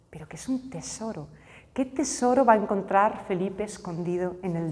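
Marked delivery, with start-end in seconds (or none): 0.1-1.3 susurrado, casi sin voz, como un secreto; whisper. Acted. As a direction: deja caer la palabra tesoro en un susurro. Angel's words end in a secret. (el ángel le revela a Felipe un tesoro, susurrando)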